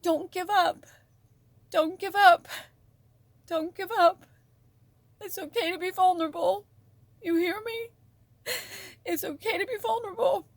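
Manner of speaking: a high, otherworldly voice of encouragement, close to crying; channeled spirit voice. Acted. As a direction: speak as a loving spirit urging them not to give up. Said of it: "and she's saying".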